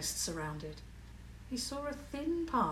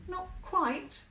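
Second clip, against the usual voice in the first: tight; 10 semitones higher than usual. A narrator's reading. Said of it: "Clara said tightly".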